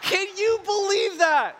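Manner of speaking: high, loud and laughing, slowly; laughing disbelief. Retold or imitated. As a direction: laugh through the words in disbelief.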